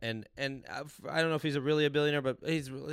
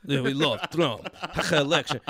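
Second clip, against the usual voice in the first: slow mock Russian accent through laughter; 7 dB louder than usual. Comic joining the Russian hacker joke, slow mock Russian accent.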